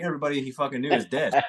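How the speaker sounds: normal talking voice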